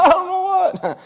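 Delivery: very high, hammed-up scared voice; exaggerated high nervous reply; mock frightened squeak. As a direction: answer like a terrified boy. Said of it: "He's like".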